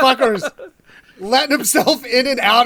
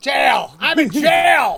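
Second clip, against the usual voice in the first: mock scream; 4 semitones higher, 5 dB louder, much slower than usual. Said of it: "he's just screaming".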